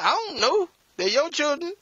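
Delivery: very high, whiny dismissal; mock sulky spouse. Preacher imitating a spouse who shrugs off the kids, high and whiny. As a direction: toss the question back, pouting.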